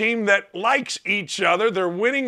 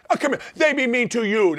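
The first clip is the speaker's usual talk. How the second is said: mock babying; 3 semitones higher, faster than usual. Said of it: "That's what I used to tell my son".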